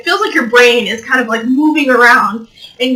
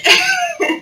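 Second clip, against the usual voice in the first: high squeaky laugh. Real, not acted. Laugh after pregnant joke.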